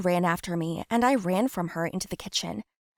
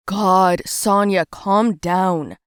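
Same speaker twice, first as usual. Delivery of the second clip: plain reading voice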